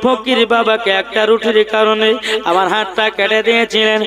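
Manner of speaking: loud, fast intoned storytelling; melodic waz preaching. Acted. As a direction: intone the tale at full voice, stretching phrase ends. (সুরেলা ওয়াজের বয়ান)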